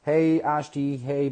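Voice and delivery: ordinary talk, nothing marked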